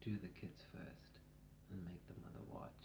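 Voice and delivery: very soft low whisper. Retold or imitated. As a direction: breathe it out low and hushed. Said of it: "she says it in her whisper voice".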